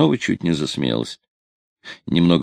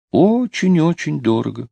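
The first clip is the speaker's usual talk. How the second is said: ОЧЕНЬ-ОЧЕНЬ pitched high and insistent; emphatic repetition; 10 semitones higher than usual. A narrator's reading. Girl repeating how expensive she is, "Очень-очень" high and insistent. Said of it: "повторила она".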